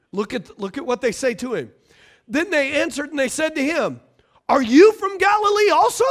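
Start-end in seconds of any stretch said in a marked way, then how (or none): 4.5-6.1 high, loud scornful shout; mocking outrage. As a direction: throw it at him in disgust. Pharisees mock Nicodemus.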